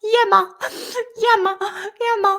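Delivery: yammer called out loud and high. Acted. Echoing the dictionary voice loudly. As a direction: belt it out playfully, high and loud.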